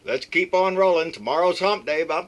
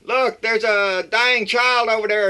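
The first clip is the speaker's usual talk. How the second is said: mock Trump yelling; 5 semitones higher than usual. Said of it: "Donald keeps yelling".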